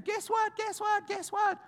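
high, squealing excited call; excited friend. Retold or imitated. Friend running up to the crying woman with news, squealing excitedly. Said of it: "her friend came running, going".

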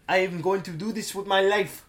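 mock-earnest French English; imitated French accent, overdone; bad put-on French accent. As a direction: declare it in a fake French accent. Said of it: "trying to do a franchise".

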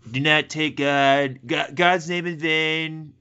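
lightly Trumpy, slow and drawn out; a hint of Trump, slow and emphatic; Trump-style quote. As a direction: mimic a Trump delivery, slowly. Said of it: "especially the way he says".

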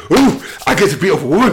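high, loud, quick giddy outburst. Acted. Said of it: "Angle's getting a bit giddy".

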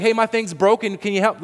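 a frustrated customer begging for help; mock caller asking the cable company for help; pleading customer.

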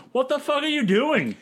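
high-pitched mock outrage; indignant outburst. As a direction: play an exasperated man demanding an explanation. Heyman goading Lesnar, acted quote.

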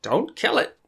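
high frantic squeal in short bursts; panicked pleading. Acted. Character begs for spider's removal.

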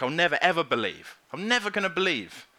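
NEVER pushed high and hard, in a stubborn refusal; emphatic refusal. Retold or imitated. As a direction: hammer the word never. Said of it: "It's, like, emphatic".

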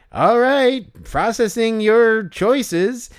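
high, slow, stilted machine announcer; canned hot dog host. Acted. Hot dog machine processes a kid's choices, canned and drawn out. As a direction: stretch it out like an automated host. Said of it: "that's a good impression that you're doing".

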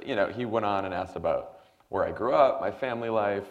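usual speaking voice

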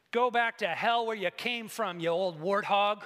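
loud, forceful acted insult; angry outburst in a storyteller's voice; angry young woman. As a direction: hurl it at her like a curse. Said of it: "angry young woman named Mary Grace".

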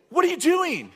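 high, loud startled shout; startled yell. Retold or imitated. Pastor startled by oil, high shocked yell. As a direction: blurt it out in shock.

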